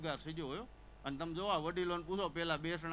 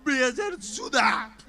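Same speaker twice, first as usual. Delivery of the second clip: high, loud, slow tearful demand; crying; 6 semitones higher, 10 dB louder, slower than usual. Retold or imitated. Friend bargaining with the host while still crying, tearful whine. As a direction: whimper it through mock tears. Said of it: "એ રોતા રોતા બોલ્યો".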